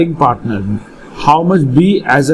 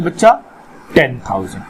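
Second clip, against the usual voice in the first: ordinary delivery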